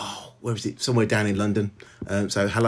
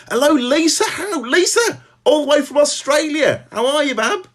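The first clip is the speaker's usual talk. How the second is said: excited greeting; 4 dB louder than usual. Real, not acted. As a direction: greet her loudly and happily, pitch way up. Excited greeting of a friend online.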